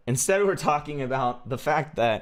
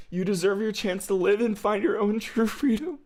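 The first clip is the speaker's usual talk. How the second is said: higher-pitched earnest plea; high sweet character voice; 10 semitones higher than usual. Acted.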